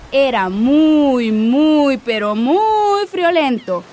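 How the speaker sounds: slow, stretched "muy, muy, pero muy"; drawn-out emphasis. A narrator's reading. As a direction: stretch each "muy" slowly.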